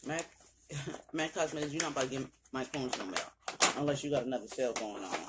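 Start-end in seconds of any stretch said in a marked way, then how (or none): none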